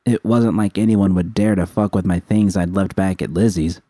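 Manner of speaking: usual speaking voice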